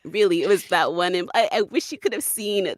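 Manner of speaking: normal talking voice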